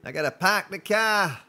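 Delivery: put-on a lightly done Boston accent. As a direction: drop the r in park and car, Boston style. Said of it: "all I know how to say with your accent".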